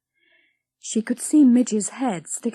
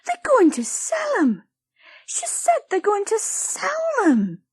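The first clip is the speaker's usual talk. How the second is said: high, upset pleading; distressed cry; 14 semitones higher, 4 dB louder than usual. A narrator's reading. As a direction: panic about the toys. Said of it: "she cried".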